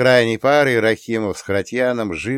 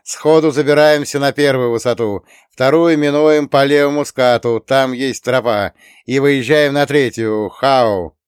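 loud, higher radio briefing; radio call; 4 semitones higher, 6 dB louder than usual. A narrator's reading. Chuck radios the route to the drivers, loud radio orders. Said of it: "зашипела рация".

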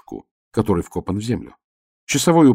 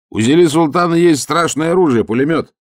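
high, loud, slowing warning; shout; 8 semitones higher, 6 dB louder than usual. Acted. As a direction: yell the threat up. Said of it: "я крикнул".

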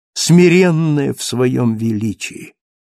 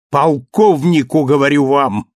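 angry cry; 5 dB louder than usual. Acted. Marius correcting Thenardier in anger, loud cry. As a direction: slam down each syllable in anger. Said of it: "вскричал Мариус гневно".

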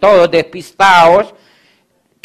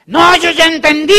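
mock young man; 12 semitones higher, 4 dB louder than usual. Young man voiced in retold chat.

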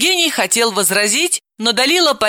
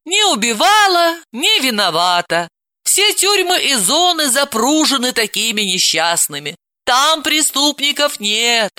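higher, mocking sarcasm; sarcastic; 7 semitones higher than usual. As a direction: pour scorn on it. Aunt mocks the excuse.

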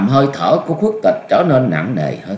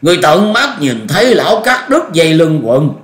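high, raised-voice incredulous demand; loud questioning; 7 semitones higher, 4 dB louder than usual. A narrator's reading. Old master demanding eyewitness confirmation, loud. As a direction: ask loudly, pitch jumping up. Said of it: "Khuất Tịch lớn tiếng hỏi".

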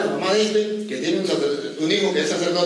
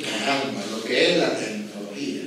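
much softer, lower marveling; quiet amazement; 10 semitones lower, 5 dB quieter than usual. Retold or imitated. Old countryman marveling at technology, soft and low. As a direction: murmur it in awe to yourself.

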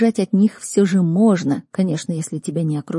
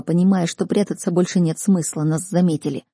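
ordinary delivery

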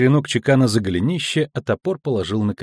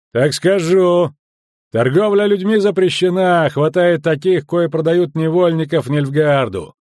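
high, forceful official declaration; hard, raised military voice; stern loud warning; 5 semitones higher, 5 dB louder than usual. Acted. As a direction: lay down the law to suspects. Sergeant states law and threatens them.

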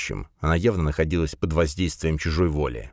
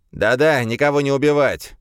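higher, louder sulky grumble; grumbling demon; 8 semitones higher, 4 dB louder than usual. A narrator's reading. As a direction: whine it like a bored demon. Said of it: "недовольно откликнулся демон".

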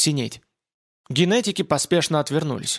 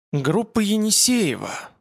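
higher, slow, strained reading; tense voice; 6 semitones higher, slower than usual. A narrator's reading. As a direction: read the name out tensely, slowly. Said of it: "напряжённым голосом". (напряжённым голосом, медленно)

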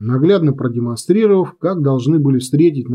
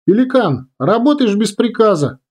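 raised, high-pitched order; radio command; 8 semitones higher than usual. A narrator's reading. Commander directing Pelican wing by radio, commanding call. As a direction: call out firm orders to a pilot.

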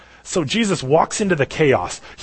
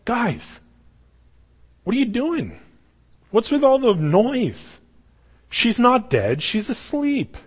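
Jesus, bewildered; 10 semitones higher, slower than usual. Retold or imitated. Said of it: "he's just like".

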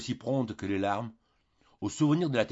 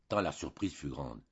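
ordinary delivery, nothing marked

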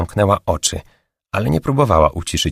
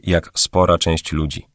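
usual speaking voice

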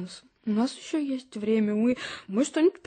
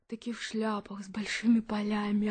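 whispered; 2 semitones lower, 7 dB quieter than usual. Acted. Mother describing dreamy picture.

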